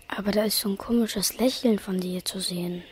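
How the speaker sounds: whispered aside. Acted. Boy teasing friend about her smile, whispered aside. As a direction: tease her under your breath.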